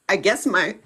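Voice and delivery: usual speaking voice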